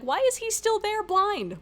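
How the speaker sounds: high-pitched baffled protest; incredulous. Retold or imitated. Viewer questioning why the blinded man remains, incredulous. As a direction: voice leaps up in exasperated disbelief. Retold incredulous reaction to the plot.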